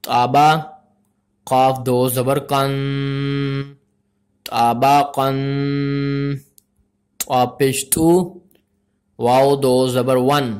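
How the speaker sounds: measured, syllable-by-syllable recitation; spelling-drill recitation. Shown as a demonstration. Teacher spells out Arabic letters for learners, measured recitation. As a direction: recite letter by letter, holding the final sounds.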